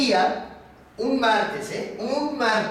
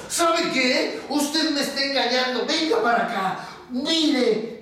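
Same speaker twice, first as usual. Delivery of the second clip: raised-pitch irritated order; very angry foreman; 5 semitones higher than usual. Acted. Foreman grabbing the bear by the ears, angry. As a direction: haul him over with sharp words. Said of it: "se puso de mal humor, enojadísimo".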